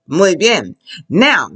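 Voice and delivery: ordinary talk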